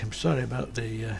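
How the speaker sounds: ordinary delivery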